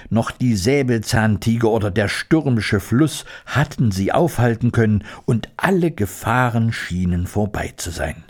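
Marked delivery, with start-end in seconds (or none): none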